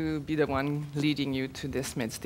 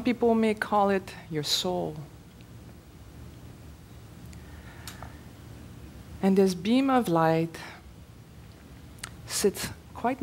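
measured meditation leader with long pauses; spacious, unhurried phrasing for a group meditation; guided meditation. Real, not acted. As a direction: guide them phrase by phrase, leaving room to breathe.

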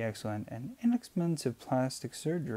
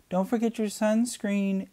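high, louder call across the beach; mother calling to her running daughter; 11 semitones higher, 5 dB louder than usual. A narrator's reading. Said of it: "Ellen called".

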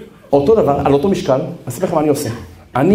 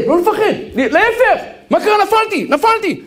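mock cheerful; slower than usual. Acting cheerful after falling.

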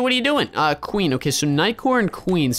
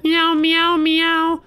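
high meowing like a cat; reading her chat as high meows; 10 semitones higher, much slower than usual. Retold or imitated. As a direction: meow like a playful kitten. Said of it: "She said".

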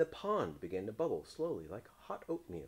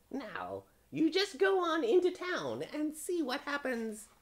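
very high, loud witch; 5 dB louder than usual. A narrator's reading. Witch sending the frog into town, high witch voice. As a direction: send them off in a piercing witchy pitch.

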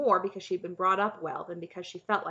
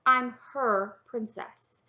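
higher, louder, very slow correction; sharp; 4 semitones higher, 8 dB louder, much slower than usual. A narrator's reading. Princess explaining whose princess she is, sharp. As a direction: spell it out sharply. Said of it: "Cimmerian said sharply".

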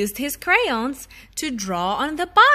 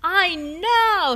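put-on excited child; 6 semitones higher than usual. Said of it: "he said".

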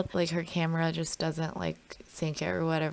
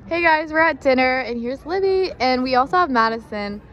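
bright vlog intro; 6 semitones higher, 8 dB louder than usual. Shown as a demonstration.

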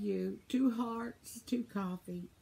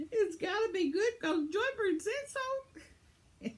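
laughing; 12 semitones higher, 3 dB louder, slower than usual. Real, not acted. Laughing about a viewer's dinner.

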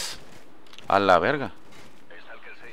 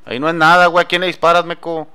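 annoyed shout; 6 semitones higher, 6 dB louder than usual. Real, not acted. Teammate shooting wrong, yelling.

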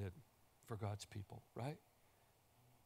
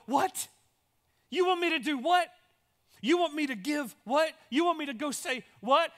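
much higher and louder, disbelieving; incredulous outcry; 11 semitones higher, 10 dB louder than usual. Acted. People stunned by God's call, shouting in disbelief. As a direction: cry out in shock at the request.

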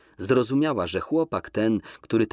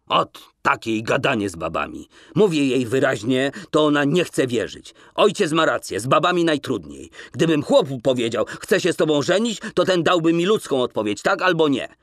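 louder, higher frustrated complaint; exasperated grumble; 5 semitones higher, 4 dB louder than usual. A narrator's reading. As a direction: vent about women, fed up. Wasyl exasperated.

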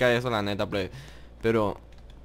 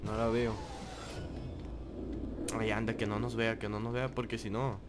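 much softer, low hushed voice; 4 semitones lower, 12 dB quieter than usual. Real, not acted. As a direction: keep it hushed, hiding. Hiding from the killer.

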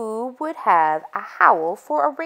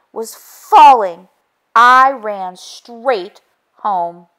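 slow stunned telling; 3 semitones higher, 7 dB louder, much slower than usual. A narrator's reading. Hen stunned at the fall.

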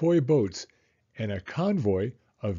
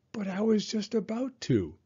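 put-on protesting kid; 9 semitones higher than usual. A narrator's reading. Son defending his unused toy, protesting. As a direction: object in a high boy's voice.

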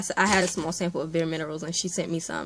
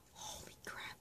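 very soft, voiceless amazement; stunned whisper; 23 dB quieter than usual. Real, not acted. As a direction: whisper it, floored by the gift. Overwhelmed by what she unpacks.

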